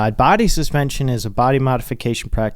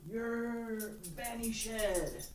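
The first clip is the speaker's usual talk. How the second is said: mock Shakespeare banishment; 11 semitones higher, 19 dB quieter, much slower than usual. Banishing the cat, joke word.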